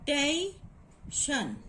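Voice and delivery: "tation" as tay, then shun; syllable breakdown. Shown as a demonstration. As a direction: split "tation" into two separate beats. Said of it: "take the sound of diphthong".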